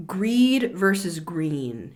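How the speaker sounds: Greed versus green said slowly, each final D and N made clear; carefully spelling out the difference between the words; contrasting greed and green. Shown as a demonstration. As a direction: slowly set Greed against green, crisp D and N endings. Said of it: "Greed is different than green".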